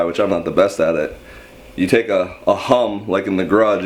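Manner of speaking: ordinary talk, nothing marked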